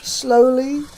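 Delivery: usual speaking voice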